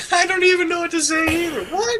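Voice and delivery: high, fast, squealed through laughter; laughing helplessly. Real, not acted. Cracking up over a funny question, high laughing squeal. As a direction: crack up while trying to speak.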